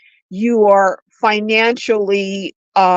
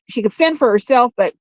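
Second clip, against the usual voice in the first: ordinary talk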